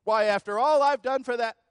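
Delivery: loud, high, indignant protest; mock complaint, pitched high and loud; self-righteous protest. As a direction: rise up in wounded self-righteous outrage. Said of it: "rise up so self-righteously and say".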